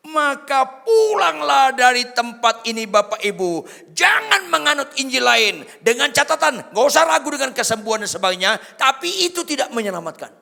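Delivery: loud, high sermon shout; full-force preaching. Real, not acted. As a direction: thunder it at the congregation.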